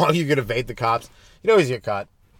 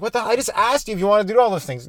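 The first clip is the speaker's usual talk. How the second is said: exasperated; 8 semitones higher than usual. Retold or imitated. Dad exasperated at toddler.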